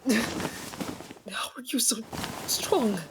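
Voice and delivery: slow, breathless whisper; shocked whisper. Acted. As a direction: hiss it out under restraint. Pinned, whispering.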